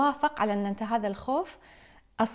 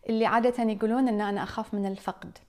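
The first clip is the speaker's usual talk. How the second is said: ordinary talk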